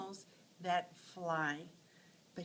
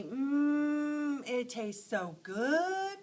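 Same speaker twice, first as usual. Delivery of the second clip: savoring mm; 6 semitones higher, 6 dB louder, much slower than usual. Acted. Mouse savoring cheese.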